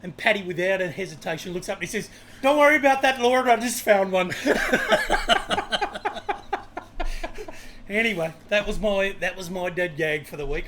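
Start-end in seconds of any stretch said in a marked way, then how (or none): 2.4-4.3 loud, high Irish punchline; hammed-up Irish man shouting up to heaven; Irish accent. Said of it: "should I do the Irish accent?". 4.4-7.8 loud, high burst of laughter; real laugh at the punchline. Real, not acted. Laughs at his own joke.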